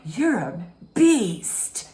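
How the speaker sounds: slow, dramatic imitated accusation; hammed-up, drawn-out insult; Eileen Davidson impression. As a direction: stretch it into a mock denunciation. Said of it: "Eileen Davidson came in her and was like".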